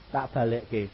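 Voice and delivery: usual speaking voice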